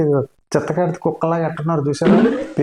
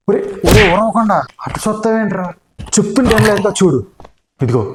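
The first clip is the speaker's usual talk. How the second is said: ordinary talk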